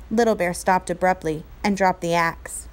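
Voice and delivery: usual speaking voice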